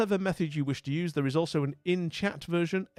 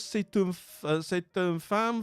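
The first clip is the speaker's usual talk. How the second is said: slow, high-pitched French praise; gushing French; 5 semitones higher, slower than usual. Pretend. Streamer praising a fabulous woman streamer, gushing French. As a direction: praise them in drawn-out theatrical French.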